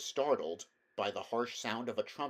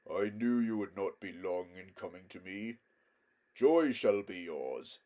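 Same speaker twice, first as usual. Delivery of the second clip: majestic lion, tender; 2 semitones lower than usual. A narrator's reading. Aslan welcomes the mare.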